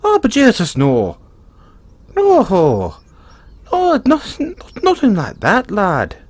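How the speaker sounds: loud, high, flustered Irish protest; a leprechaun hastily insisting he means no harm; flustered denial. Acted. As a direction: blurt it out, caught off guard. Flustered denial of being yandere.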